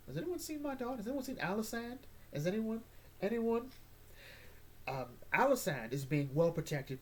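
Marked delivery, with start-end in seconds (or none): 0.1-3.7 worried captain. Retold or imitated. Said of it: "the Captain Guard's like".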